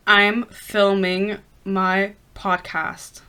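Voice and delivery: very slow, word by word; reading while typing. Real, not acted. Podcaster texting boyfriend, slowly voicing each word while typing. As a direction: spell it out slowly as you type.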